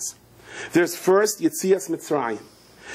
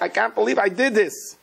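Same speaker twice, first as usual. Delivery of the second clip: mock anguish; 6 semitones higher than usual. Acts self-reproach after petty fight.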